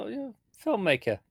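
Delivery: mock-casual, light and high admission; easing it in. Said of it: "she starts, like, easing it in".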